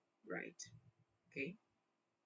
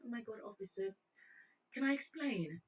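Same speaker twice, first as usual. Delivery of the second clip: high, soft put-on British; British accent; 5 semitones higher, 4 dB quieter than usual. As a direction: appeal sweetly to the officer in mock British. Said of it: "broke in a one British accent".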